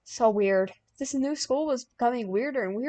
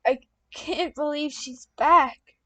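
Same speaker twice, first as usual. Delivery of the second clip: put-on higher, slower girlish voice; raised slower character voice; 6 semitones higher, 4 dB louder than usual. A narrator's reading. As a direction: lift it up and slow it, a worried girl. Character voice, dialogue.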